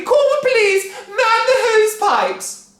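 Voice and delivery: high, loud, slow alarm; frantic cry. A narrator's reading. Wife crying out for the fire brigade, loud and high. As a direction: cry out for help. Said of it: "cried Missus Cranky".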